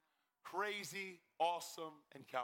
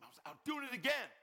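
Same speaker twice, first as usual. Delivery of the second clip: raised, exasperated retort; exasperated confession; 6 semitones higher, 4 dB louder than usual. Retold or imitated. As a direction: retell it higher, fed up. Retold exasperated call to pastor.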